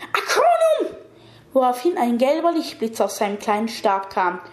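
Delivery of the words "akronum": very high, drawn-out spell cry; shrill shout. A narrator's reading. Young man casts a spell at the old man, shrill shout. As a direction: shriek the incantation at a piercing pitch. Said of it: "Der junge Mann schrie schrill".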